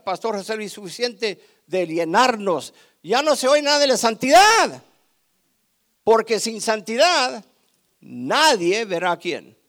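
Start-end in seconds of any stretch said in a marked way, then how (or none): 3.0-9.6 loud, building preacher's cry; preaching at full force. Real, not acted. Preacher thundering holiness at congregation, loud. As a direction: thunder it at the church.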